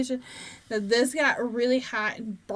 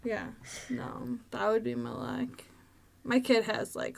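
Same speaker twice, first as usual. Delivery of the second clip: normal talking voice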